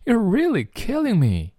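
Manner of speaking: playful high delivery; amused. Shown as a demonstration. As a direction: say it like you can't stop laughing. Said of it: "真是笑死我了".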